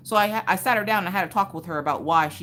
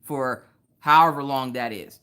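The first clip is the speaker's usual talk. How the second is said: firm; 2 semitones lower, 6 dB louder than usual. Real, not acted. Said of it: "Miss Coffee ain't that parent".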